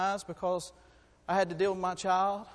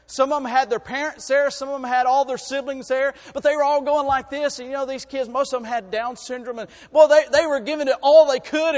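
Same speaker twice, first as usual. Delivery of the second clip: loud, high, building sermon voice; full-force preaching; 5 semitones higher, 9 dB louder than usual. Acted. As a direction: preach it out to the whole church.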